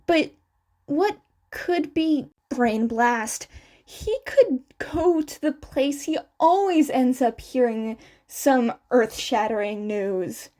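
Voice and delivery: plain reading voice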